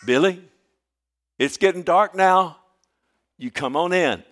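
high, loud call across the farm; loud high call into the distance. Retold or imitated. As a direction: grandmother hollering the boy home at dusk. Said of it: "she'd call me".

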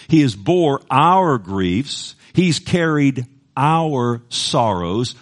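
slow, emphatic reading of the verse; solemn scripture reading. Acted. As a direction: lean on each word of scripture. Reads verse again emphatically.

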